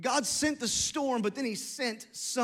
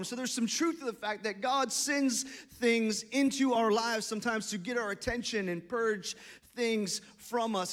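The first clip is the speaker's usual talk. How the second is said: normal talking voice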